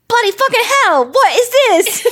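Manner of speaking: mock British accent. Co-host mimicking 1971 English boys finding stone heads, hammed-up British accent. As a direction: squeal it like a cartoon English schoolboy.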